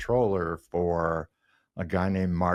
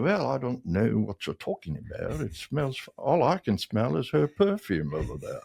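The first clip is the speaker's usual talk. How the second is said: put-on higher-pitched British accent; British owner's accent, pitched up; Nigel; 6 semitones higher than usual. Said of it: "That's a pretty good accent there".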